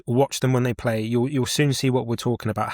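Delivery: ordinary delivery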